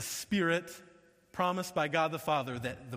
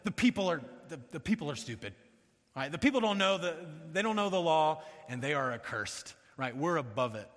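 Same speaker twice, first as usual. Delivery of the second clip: mock contempt. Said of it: "to demonize the other position".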